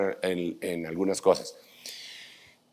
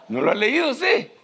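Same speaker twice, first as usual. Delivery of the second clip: much higher, laughing question; amused teasing. Real, not acted. Preacher joking with the congregation about Bible legends, amused teasing. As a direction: tease them in a high, laughing voice.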